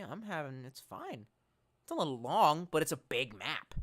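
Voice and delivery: ordinary delivery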